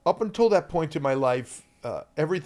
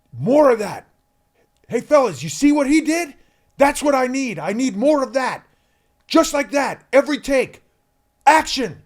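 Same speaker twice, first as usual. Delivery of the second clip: loud, high, delighted praise; excited director; 11 semitones higher, 11 dB louder than usual. Retold or imitated. Director praising the boy to the other kids, loud excited. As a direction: cheer him on like a thrilled director. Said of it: "And he went".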